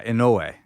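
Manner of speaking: "Inoue" as in-OH-way, with a long O; stress on the O. Shown as a demonstration. Host trying out the name for his co-host, stressed O. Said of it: "emphasis on the O".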